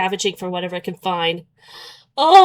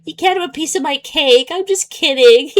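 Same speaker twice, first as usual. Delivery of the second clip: higher, sing-song fake kidding; mock teasing; 6 semitones higher than usual. Mocking the guy who says he's kidding, higher and teasing. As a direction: tease like a smug joker. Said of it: "then you say".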